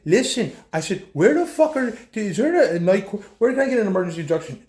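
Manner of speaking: loud, high, pressing questions; retold furious rant at the cop; angry demand. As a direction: push hard for answers, fast at the end. Retelling his demand to the cop.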